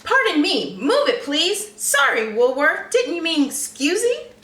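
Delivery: high, loud barging piggy voice; pushy pig voice. Acted. Pig shoving past friends, loud and high. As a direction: shove past them in a big pig voice.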